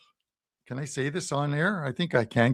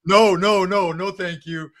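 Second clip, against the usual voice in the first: mock panicked refusal; 4 semitones higher, 5 dB louder than usual. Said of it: "in my head, I'm saying".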